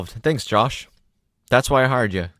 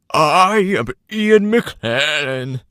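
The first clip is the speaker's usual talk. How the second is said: far slower, higher mock-grand McKellen; Ian McKellen; 5 semitones higher, 4 dB louder, much slower than usual. Co-host trying Ian McKellen on air. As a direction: intone the name like a pompous wizard. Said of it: "Let's try and do them".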